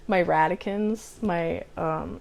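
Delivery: ordinary talk, nothing marked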